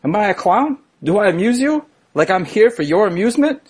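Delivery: high-pitched, indignant movie gangster questioning; Joe Pesci. Acted. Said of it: "Imagine a life without Joe Pesci asking".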